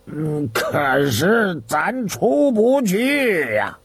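slow, doubtful reply; a hint of helpless youngster; lightly voiced Zhang Dalang. A narrator's reading. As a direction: draw it out helplessly. Voices the boy in dialogue.

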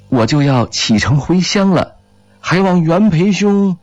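lightly voiced character, pitched high; earnest farewell request. A narrator's reading. Character voiced, addressing his friend before leaving.

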